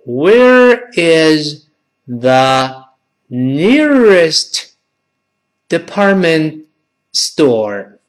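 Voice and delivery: very slow, a pause between words; word-by-word model. Shown as a demonstration. Teacher breaks down the question for class, slow reading. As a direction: stretch each word separately for learners.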